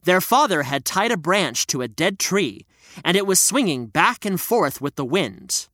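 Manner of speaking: ordinary delivery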